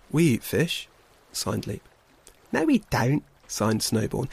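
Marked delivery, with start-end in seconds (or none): 2.5-3.2 high-pitched cub voice; indignant little brother. Acted. Little bear contradicts his big brother, high and indignant. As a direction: pipe up to contradict him.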